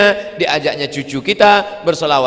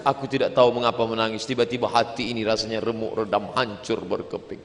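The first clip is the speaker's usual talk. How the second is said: low, soft, fast murmur; subdued acted heartbreak; broken-hearted confession; 5 semitones lower, 6 dB quieter than usual. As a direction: confess it quietly, sinking away. Acts tough artist quietly confessing.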